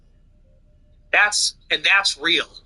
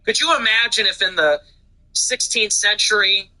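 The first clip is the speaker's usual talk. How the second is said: ordinary talk, nothing marked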